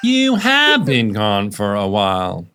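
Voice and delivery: slow, "have" hit heavily; stress on have. Retold or imitated. Host mimicking the ad read, weighing "have". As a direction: underline "have" slowly. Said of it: "underlining have".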